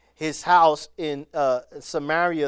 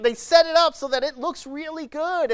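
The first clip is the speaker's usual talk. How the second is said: ordinary talk, nothing marked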